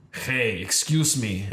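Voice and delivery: slow, imitated Russian accent; thick Russian accent. Russian lady hailing a stranger at a restaurant, thick Russian accent. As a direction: call out slowly in a Russian accent. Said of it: "very thick Russian accent".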